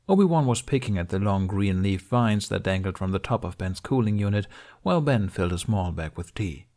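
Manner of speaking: usual speaking voice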